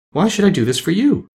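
high-pitched lady's questioning voice; lovely lady. A narrator's reading. The lady questions the Scarecrow's plea, high and light. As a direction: ask in a high, ladylike voice. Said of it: "asked the lady".

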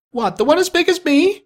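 put-on very high young boy; boy's voice. A narrator's reading. Boy answering Scrooge from below, high boyish voice. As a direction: pipe up, astonished. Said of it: "returned the boy".

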